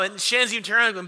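ordinary talk, nothing marked

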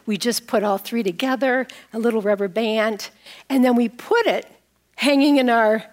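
ordinary talk, nothing marked

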